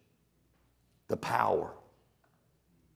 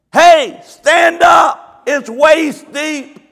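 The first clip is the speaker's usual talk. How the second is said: slow loud shout; 12 semitones higher, 10 dB louder, much slower than usual. Retold or imitated. Pastor in the river yelling to panicking ladies, slow loud shout. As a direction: call out slowly, high and loud.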